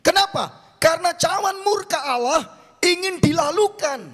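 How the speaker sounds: high, loud declamation with stretched key words; preaching at full force. Real, not acted. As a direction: proclaim it to the whole congregation, high and loud. (khotbah lantang berapi-api)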